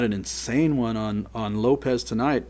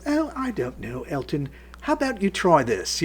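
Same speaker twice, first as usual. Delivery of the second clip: high-pitched imitated English accent; 6 semitones higher than usual. Host suggesting a song to [name], imitated English accent. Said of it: "in her English accent".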